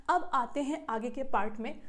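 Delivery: ordinary delivery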